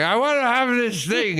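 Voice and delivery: a hint of a befuddled old person; old person in shop. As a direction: raise the pitch like a confused old customer. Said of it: "like, an old person in a shop".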